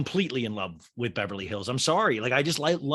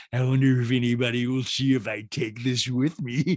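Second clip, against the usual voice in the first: slurred, slushy celebrity caricature; scheming reality star with a droopy mouth; Lisa Rinna. Acted. Reality star plots to take furs, slurred impression. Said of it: "And Rinna, you could tell, was just like".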